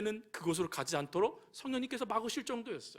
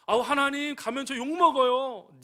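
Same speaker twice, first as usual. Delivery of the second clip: higher, louder complaining plea; a believer whining to God, loud and slow; pleading whine; 5 semitones higher, 5 dB louder than usual. Acted. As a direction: beg God to let you off.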